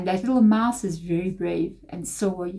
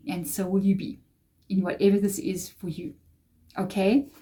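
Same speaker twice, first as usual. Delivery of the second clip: ordinary talk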